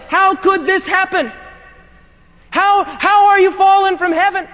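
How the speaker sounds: incredulous prophet. Retold or imitated. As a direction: voice the prophet who can't believe it. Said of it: "the prophet of God is incredulous".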